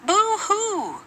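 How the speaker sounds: high, put-on mock crying. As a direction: cry out over the lost saxophone. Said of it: "cried Lisa".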